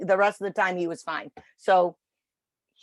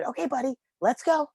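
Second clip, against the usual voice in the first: high-pitched coaxing; 6 semitones higher than usual. Retold or imitated. Trainer inviting a rested dog onward, high-pitched coaxing. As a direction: coax gently in a small high voice. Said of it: "like Mickey Mouse".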